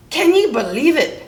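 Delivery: high-pitched exasperated moan; pirate moaning. A narrator's reading. Pete complains to his parrot, high exasperated moan. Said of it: "Pete moaned to his parrot".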